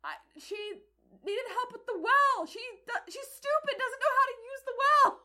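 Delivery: put-on high, loud kid's protest building to a yell; defensive boy. Son justifies himself to furious mother, indignant.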